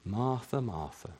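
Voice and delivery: soft, low, tender calling of her name; calm her down softly; gentle rebuke. Acted. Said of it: "I can almost imagine the tone".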